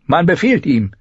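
high-pitched, cutting comeback; sharp-tongued older servant answering back; sharp retort. A narrator's reading. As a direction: snap it back at her. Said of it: "gab Miri scharf zurück".

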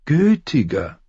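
smooth and falsely sympathetic, like a sly courtier; oily eunuch. Acted. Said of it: "sagte Varys". (salbungsvoller Höfling, deutlich höher)

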